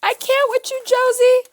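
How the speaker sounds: high, loud squeal of delight; excited shriek. Real, not acted. Friend shrieking with joy at Josie's gift. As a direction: scream it with pure delight.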